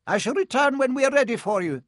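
formal strict schoolmistress; stern professor. A narrator's reading. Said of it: "said Professor McGonagall".

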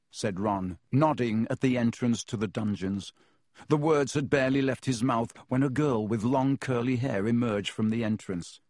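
usual speaking voice

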